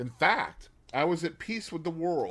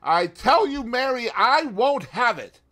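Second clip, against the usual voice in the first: John shouts, much higher and louder; shouting; 11 semitones higher, 8 dB louder than usual. A narrator's reading. Husband shouting at his wife in a quarrel, furious shout. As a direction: bellow it in a jealous fury. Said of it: "John repeated the words".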